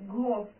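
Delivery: "ghost" said "gost", no H sound; teacher demo of "ghost" with silent H. Shown as a demonstration. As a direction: drop the H in "ghost".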